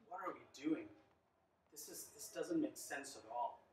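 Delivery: soft, low muttered self-doubt; doubting inner voice. Retold or imitated. Husband doubting himself on the drive, quiet worry. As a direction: murmur it to yourself, worried. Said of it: "I'm thinking to myself".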